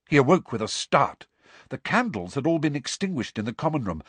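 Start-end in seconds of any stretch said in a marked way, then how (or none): none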